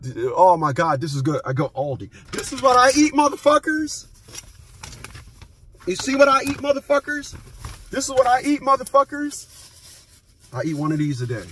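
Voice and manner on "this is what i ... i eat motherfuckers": mock squeaky brag; high taunting voice. Vlogger brags about his snacks to viewers, high and teasing.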